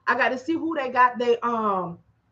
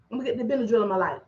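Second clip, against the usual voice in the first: ordinary talk, nothing marked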